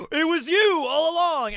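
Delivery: gasp, then a high, loud accusing reveal; hammed-up cliffhanger shock; mock dramatic realization. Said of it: "looking super shocked".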